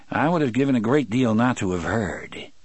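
usual speaking voice

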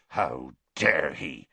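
low, slow indignant growl. A narrator's reading. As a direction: growl it out slowly in outrage. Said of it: "Hagrid growled".

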